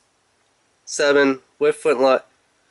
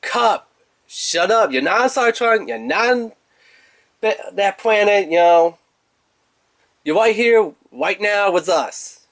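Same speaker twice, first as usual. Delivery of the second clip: exasperated telling-off; 7 semitones higher than usual. Retold or imitated. Reviewer playfully telling Kup to stop his war stories, mock scolding. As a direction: roll your eyes and tell him off. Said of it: "And I was like".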